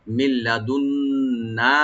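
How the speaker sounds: মিল্লাদুন্না with merged ল and a nasal হum on ন্না, slow; ghunna demo. Shown as a demonstration. Teacher showing students the required nasal sound. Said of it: "নুনে মুসাদ্দাদ এখানে ওয়াজিব গুন্না হবে".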